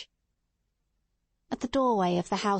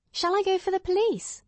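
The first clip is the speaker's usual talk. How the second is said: eager; 11 semitones higher than usual. A narrator's reading. Watson eager to act.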